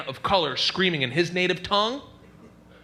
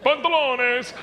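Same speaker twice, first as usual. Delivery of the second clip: put-on Italian accent; 10 semitones higher than usual. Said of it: "like Italian and Spanish".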